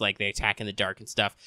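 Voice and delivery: normal talking voice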